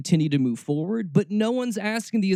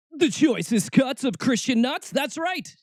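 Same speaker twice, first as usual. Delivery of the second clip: high-pitched showman's build-up; hyped segment announcer; 5 semitones higher than usual. Pretend. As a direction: announce it big, like a comic ringmaster. Announcing a comic show segment.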